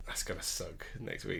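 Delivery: soft unvoiced aside; whispering. Real, not acted. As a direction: breathe it under your breath. Muttered aside.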